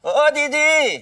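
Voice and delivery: high, drawn-out call to his sister. Acted. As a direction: sing out the call, stretched high. (ऊँचे सुर में पुकारना)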